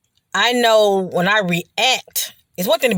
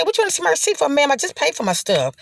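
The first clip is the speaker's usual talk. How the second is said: much higher, irritated questioning of the clerk; indignant retort; 9 semitones higher, faster than usual. Retold or imitated. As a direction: snap back at the cashier, retold annoyance. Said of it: "I was just so ticked off".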